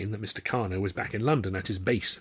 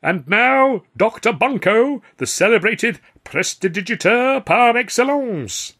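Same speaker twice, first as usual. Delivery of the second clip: much higher, louder, slow showman announcement; announcer; 12 semitones higher, 8 dB louder, slower than usual. A narrator's reading. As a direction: call it to the house grandly. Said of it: "raised his voice ... to announce".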